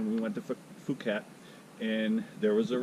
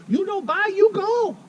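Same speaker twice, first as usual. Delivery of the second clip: much higher and louder, almost a squeal; shrill mock Thai ultimatum; 9 dB louder than usual. Waitress ordering him out, in a very high, loud mock Thai accent. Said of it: "She go".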